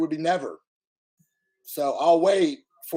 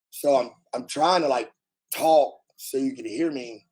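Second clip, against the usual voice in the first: ordinary talk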